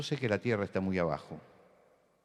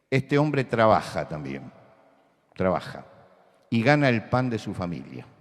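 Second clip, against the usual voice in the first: predicación enérgica; 2 semitones higher, 10 dB louder than usual. Acted. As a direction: preach it out to the congregation. Preaching at full force. (predicación fuerte y lenta)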